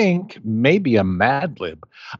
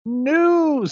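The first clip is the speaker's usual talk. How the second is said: announcer voice; 13 semitones higher than usual. Acted. Announcing segment.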